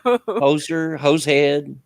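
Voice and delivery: playful Canadian caricature; mock Canadian slang. American streamer teasing Canadians in a hammed-up Canadian accent. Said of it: "all you Canadians used that term".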